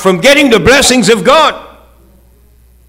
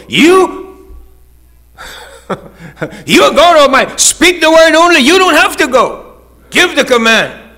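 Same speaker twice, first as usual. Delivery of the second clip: much higher, louder plea; fervent centurion; 10 semitones higher, 3 dB louder than usual. Acted. Centurion pleading with Jesus, high and fervent. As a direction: urge the Lord to just speak.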